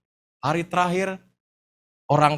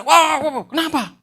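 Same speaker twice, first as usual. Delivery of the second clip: high, loud mock scream; imitated panicked yelling; 9 semitones higher, 6 dB louder than usual. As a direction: act out a crew member yelling in alarm. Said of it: "dia teriak-teriak".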